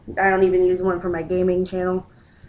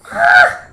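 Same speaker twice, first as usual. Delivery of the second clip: sudden high squeal of delight; surprised squeal; 11 dB louder than usual. Real, not acted. Owner unwrapping the cat's box, high squeal of surprise. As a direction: shriek as the toy appears.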